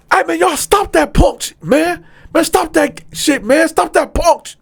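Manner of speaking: high, loud yell through the wall; shouting across the cell block; yelled call-out. Retold or imitated. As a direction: holler at them to stop. Yelling at the neighbours through the vent.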